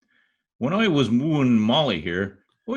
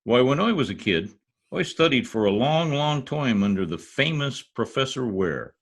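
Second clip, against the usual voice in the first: ordinary talk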